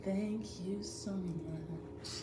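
slow, low, soft and drawn out; slow soft thanks. Real, not acted. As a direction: breathe it out slowly and gently.

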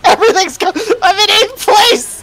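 high laughing yelp over the race; laughing shriek. Real, not acted. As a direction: cry out through laughter. Laughing shock at race position.

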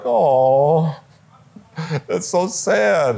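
mock sad. Viewers react to sad commercial.

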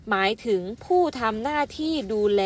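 ordinary talk, nothing marked

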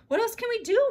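high, loud desperate cry; mother desperate at the crowd. A narrator's reading. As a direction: throw up your hands. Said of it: "Mother sounded desperate".

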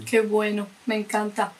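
chewing while talking; speaking around food; mouth full. Real, not acted. Seen 1: Woman bit cake at -3, mouth working while speaking early words.

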